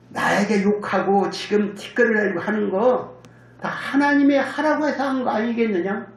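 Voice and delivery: usual speaking voice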